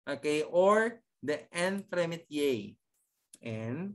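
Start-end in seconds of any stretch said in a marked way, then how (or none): 3.5-3.9 quiet, spaced-out teaching take of Entremet-; syllable by syllable. Shown as a demonstration. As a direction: sound out Entremet- piece by piece, leaving gaps. Teacher sounds out term by syllable.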